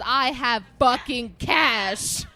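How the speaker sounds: loud, slow furious yell; angry shout. Retold or imitated. Girlfriend yelling at her dad, furious. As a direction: snap back at dad in fury. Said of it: "flips a Yui and says".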